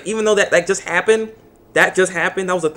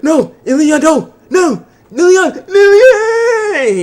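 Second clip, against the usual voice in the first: much higher, louder desperate shout, last name drawn out; anguished cry; 13 semitones higher, 7 dB louder, slower than usual. Retold or imitated. Hero begging Liliana to wake up, desperate shout. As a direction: cry out to a lost ally. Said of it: "And so he's like".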